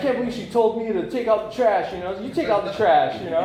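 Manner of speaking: high, loud, laughing mockery of his younger self; sending up his own sulking while laughing; mock whining, laughing. Imitating his sulking Starbucks self, high and laughing.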